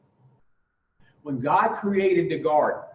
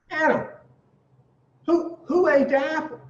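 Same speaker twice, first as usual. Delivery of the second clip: God's voice, much higher, calling down; booming God; 12 semitones higher than usual. Acted. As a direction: call out as God, demanding an answer. God demands who ate apple.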